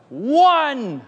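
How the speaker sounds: put-on high, loud childish shriek; imitated little boy's outburst; child's scream. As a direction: scream the number out like a frustrated kid. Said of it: "And then he screams out".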